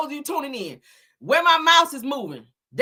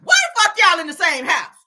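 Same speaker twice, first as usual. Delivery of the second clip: raised incredulous voice; loud, like she can't believe it; loud outburst; 6 semitones higher, 6 dB louder than usual. Real, not acted. Reacting loudly to the clip.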